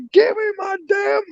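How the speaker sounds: high-pitched re-enacted shout; retold angry demand. Furious customer retold demanding money at the counter, high angry shout. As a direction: replay yelling at the counter, voice jumping way up.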